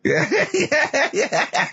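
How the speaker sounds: high-pitched laughing yeahs. Real, not acted. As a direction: crack up helplessly while agreeing. Bursts out laughing at co-host.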